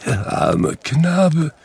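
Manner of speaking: put-on high, slow, tearful old voice; Scrooge weeping. A narrator's reading. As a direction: choke it out through tears, slowly. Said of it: "Und er weinte".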